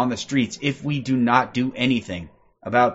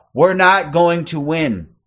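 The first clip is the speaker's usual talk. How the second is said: normal talking voice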